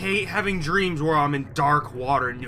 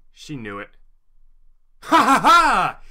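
usual speaking voice

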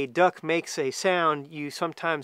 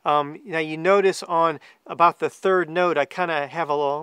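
ordinary talk, nothing marked

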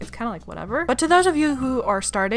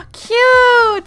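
much higher, louder squeal; squealing cute; 13 semitones higher, 10 dB louder than usual. Real, not acted. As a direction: squeal at something adorable. Reacting to a new plush.

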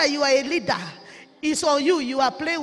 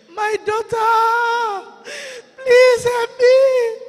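very slow, high, tearful cry for help; crying plea; 8 semitones higher, 8 dB louder, much slower than usual. Retold or imitated. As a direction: drag it out as a wailing, loud plea. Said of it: "instantly called my name".

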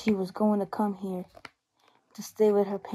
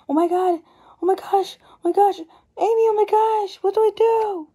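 high, loud, frantic panic; panicked crying out; 11 semitones higher, 7 dB louder than usual. Acted. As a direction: cry out helplessly over the bleeding girl. Sister bleeding, panic.

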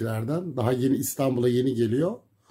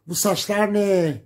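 high, slow, louder shriek; screaming mother; 8 semitones higher, 4 dB louder, slower than usual. Retold or imitated. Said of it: "diye bağırdı kadın böyle ama nasıl bağırıyor? Çığlık çığlığa". (çığlık çığlığa bağıran anne)

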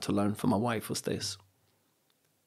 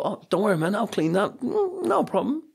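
much higher, easygoing host voice; breezy reassurance; 10 semitones higher, 4 dB louder than usual. Retold or imitated. Host to a guest who spilt tea, breezy reassurance. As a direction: wave it off in a high, accommodating voice.

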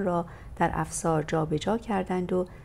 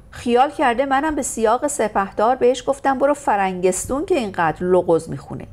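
indignant complaint; 5 semitones higher, 8 dB louder than usual. Acted. Complains to sister.

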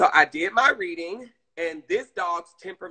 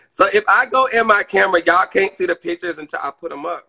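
louder repeat; 2 semitones higher, 11 dB louder, faster than usual. Real, not acted.

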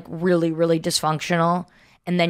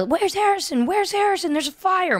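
much higher, theatrical alarmed cry; fake panic; 13 semitones higher than usual. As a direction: shriek it in pretend alarm. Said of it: "And we'd say".

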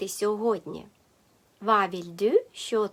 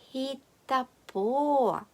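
"Хітапо" slowly, with a long stressed "по"; stress on last syllable; 3 semitones higher, much slower than usual. Shown as a demonstration. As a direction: stretch the final syllable of "Хітапо". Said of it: "Наголос на". («Хітапо» повільно, з наголосом на «по»)

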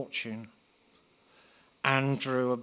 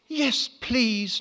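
very high breathy little yes; whimpered; 11 semitones higher than usual. Acted. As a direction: squeak it out eagerly. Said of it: "Natasha whimpered".

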